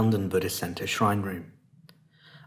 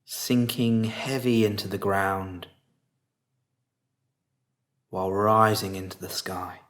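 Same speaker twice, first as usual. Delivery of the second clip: guided meditation; 2 semitones lower, 4 dB quieter than usual. Real, not acted. Teacher guiding listeners to relax face and body, calm meditation voice.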